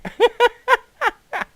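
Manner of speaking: shrieking with laughter; helpless laughing fit; loud high laugh. Real, not acted. Laughs hard.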